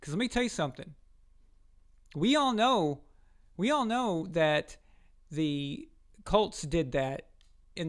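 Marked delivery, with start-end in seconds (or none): 2.2-4.8 WE ALL KNOW hit high and loud, repeated for effect; emphatic insistence. Real, not acted. Host tells viewers what the Colts did, emphatic insistence. Makes a pointed, repeated accusation.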